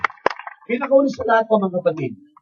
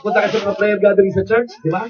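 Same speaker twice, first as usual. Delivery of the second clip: usual speaking voice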